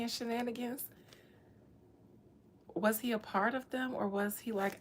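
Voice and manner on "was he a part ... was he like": normal talking voice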